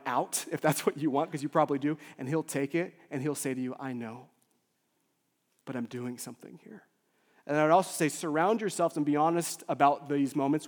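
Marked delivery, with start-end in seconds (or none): 3.8-6.8 soft, low, knowing reply; hushed, patient comfort; gentle divine reassurance. Acted. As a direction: answer quietly and steadily. Voices Jesus's gentle answer.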